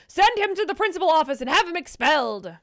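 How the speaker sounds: lightly acted indignant film quote; Linus. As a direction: declare it high and loud, hammed-up. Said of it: "imitation of Linus slash Minus from Shark Boy".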